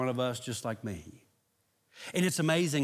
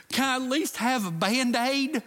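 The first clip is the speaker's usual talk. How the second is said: put-on high, small child's voice; little nephew pleading; 8 semitones higher than usual. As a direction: plead like a hurt little boy. Crying nephew asks for Band-Aid.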